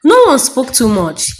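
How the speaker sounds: usual speaking voice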